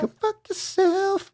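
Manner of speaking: very high, slow cartoon squeak; chipmunk-like squeaky insult.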